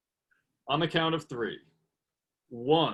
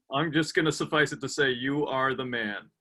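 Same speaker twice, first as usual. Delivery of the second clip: ordinary delivery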